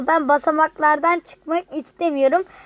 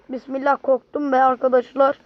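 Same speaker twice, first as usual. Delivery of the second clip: ordinary delivery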